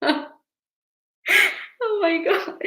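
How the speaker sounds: high, drawn-out mock sobbing; pretend crying. Teacher pretending to cry on her live, mock tearful. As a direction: fake a tearful wail. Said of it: "and I'm crying".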